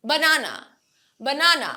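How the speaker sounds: BANANA as buh-NAA-nuh; weak first syllable, stressed middle in banana; banana with a quick reduced first vowel; schwa in banana. Shown as a demonstration. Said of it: "না, okay?".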